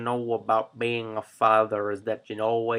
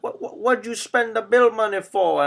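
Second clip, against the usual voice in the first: high, louder incredulous outburst; exasperated father; 10 semitones higher, 5 dB louder than usual. Retold or imitated. Father questioning kid about bill money, mock exasperation. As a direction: stammer it out in mock outrage.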